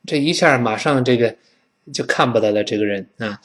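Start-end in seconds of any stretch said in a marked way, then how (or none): none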